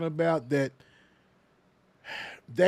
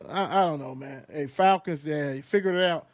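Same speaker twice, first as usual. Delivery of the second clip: usual speaking voice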